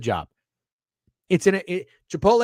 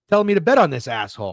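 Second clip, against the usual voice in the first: ordinary delivery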